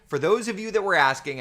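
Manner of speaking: joking line. Pretend. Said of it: "I tried to make the stupid joke".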